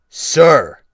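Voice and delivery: loud roared correction; thundering. Acted. Captain demanding respect from the boy, thundering shout. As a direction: bark it like a furious captain. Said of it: "Wolf Larson thundered".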